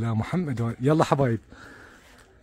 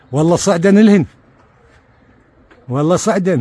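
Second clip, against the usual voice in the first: excited; 4 semitones higher, 10 dB louder than usual. Real, not acted. Chicks climbing, excitement.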